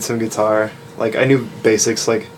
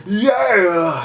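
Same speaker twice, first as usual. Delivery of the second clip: backing vocal yeah; 8 semitones higher than usual. Retold or imitated. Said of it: "backing little, like".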